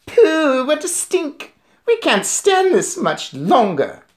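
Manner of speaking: high-pitched witch wail; disgusted witches' cries. A narrator's reading. Witches holding their noses at the smell, crying out in disgust. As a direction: cry out at the stench, high and drawn out. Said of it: "there were cries of".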